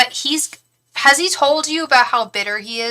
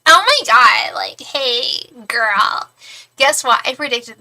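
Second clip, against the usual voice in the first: mock smug gushing; 2 semitones higher, 6 dB louder, slower than usual. Psychic mocking a smug "I told you so" to Crystal, gushing. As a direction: gush it like a gloating friend. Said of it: "I'm not trying to be like".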